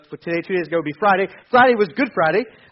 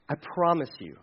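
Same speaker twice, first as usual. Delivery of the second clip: much softer, lower, gentle promise; tender promise; 7 semitones lower, 11 dB quieter than usual. Retold or imitated. Jesus promising the dying thief paradise, tender. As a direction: speak it softly, full of mercy. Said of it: "Jesus says".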